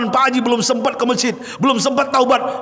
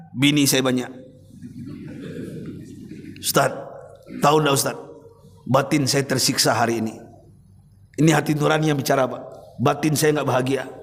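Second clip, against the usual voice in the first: put-on lower, subdued voice of a tearful old man; mild tearful confession, lower pitch; weeping old man; 7 semitones lower, 5 dB quieter than usual. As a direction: voice the crying rich elder, held low. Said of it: "menangis beliau".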